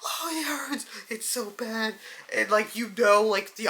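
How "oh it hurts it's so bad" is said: hammed-up fake pain, pitched higher; mock pained whine. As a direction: whine as a man faking that it hurts. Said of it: "He's like".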